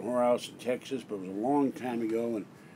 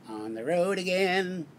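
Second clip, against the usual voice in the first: [name] voice; 6 semitones higher, slower than usual. Pretend. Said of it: "I'd like to practice, get his voice down".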